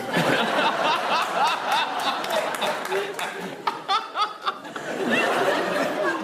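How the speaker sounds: helpless high giggling laugh; can't stop laughing; long high laugh. Real, not acted. Host cracking up at a cheeky remark, long high laugh.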